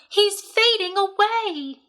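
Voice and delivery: high, slow frightened cry; alarmed. A narrator's reading. Fern crying that Wilbur is fading, high and slow. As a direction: let panic rise as he goes limp. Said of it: "screamed Fern".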